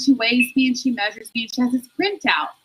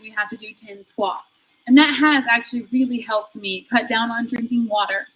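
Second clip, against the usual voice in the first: ordinary talk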